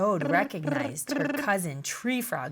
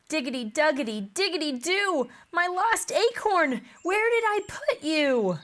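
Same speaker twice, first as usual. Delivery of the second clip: frantic; 7 semitones higher, 3 dB louder than usual. Acted. Squirrel frantically searching.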